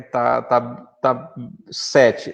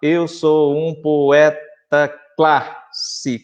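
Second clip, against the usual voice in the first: slow poetic count; much slower than usual. Shown as a demonstration. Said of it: "mas o que vai contar".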